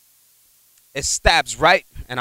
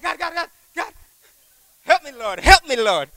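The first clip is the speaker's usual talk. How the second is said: high, pleading cries for help; desperate plea to God; 12 semitones higher than usual. Retold or imitated. Trucker begging God as his truck nearly flips. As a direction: beg the Lord in panic. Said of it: "I was calling out to God quick".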